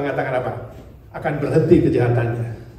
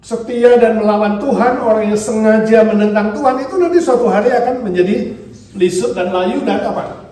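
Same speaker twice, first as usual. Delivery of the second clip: loud, high sermon delivery; preaching at full force; 6 semitones higher, 7 dB louder than usual. Acted. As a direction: preach it out over the congregation. (berkhotbah dengan lantang)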